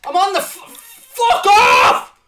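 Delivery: slow, high, loud furious shout. Real, not acted. Gamer cursing his own misclick, furious shout. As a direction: roar it at the controller.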